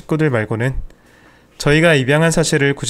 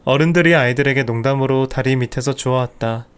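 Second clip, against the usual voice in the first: plain reading voice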